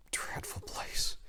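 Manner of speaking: soft slow whisper. Acted. Fallen angel whispers about the place he wants to leave. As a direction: breathe the words out, barely voiced. Angel ends his plea.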